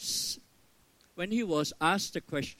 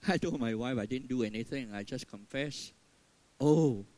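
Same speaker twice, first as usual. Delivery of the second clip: ordinary talk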